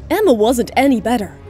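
ordinary talk, nothing marked

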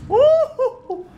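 high laughing whoop; high relieved whoop. Real, not acted. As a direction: let out a giddy whoop. Relief after escaping. (grito de alivio)

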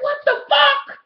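very high, loud disbelief; mock shock. Realizing the man is soliciting, high shocked squeal.

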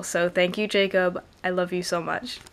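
normal talking voice

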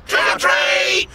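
high, slow shouted trick or treat; yelling. A narrator's reading. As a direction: yell it out together. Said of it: "they all yelled out in unison".

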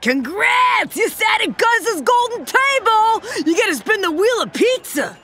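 high, bright shouted congratulations; excited announcement. Acted. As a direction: announce the prize with big excitement. Waitress announcing prize excitedly.

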